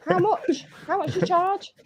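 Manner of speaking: high-pitched teasing ask; squeaky put-on voice; playful high voice asking price; mock high voice. Mock sex line customer.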